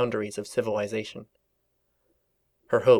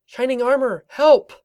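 panicked cry for help; 6 dB louder than usual. Acted. Fleeing guards, cries for help.

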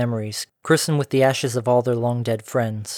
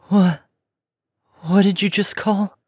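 put-on higher, startled young pony voice; gasping disbelief; 7 semitones higher, 3 dB louder than usual. A narrator's reading. Young pony stunned to hear her name, higher and startled. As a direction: blurt it out in shock. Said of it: "The last pony gasped".

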